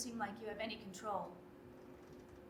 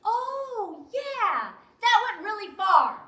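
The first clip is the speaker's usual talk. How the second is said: sarcastic; 8 dB louder, slower than usual. Acted. Scornful reply to loan claim.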